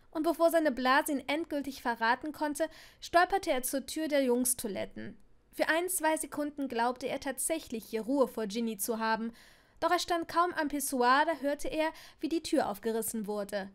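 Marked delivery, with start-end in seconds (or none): none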